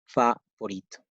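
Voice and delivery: favorito split after an open "fah"; correct Spanish pronunciation. Shown as a demonstration. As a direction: model favorito as fah, pause, vo-REE-to. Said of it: "Please pronounce it like".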